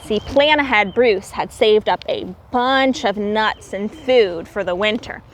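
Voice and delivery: ordinary delivery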